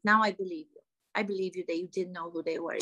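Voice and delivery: normal talking voice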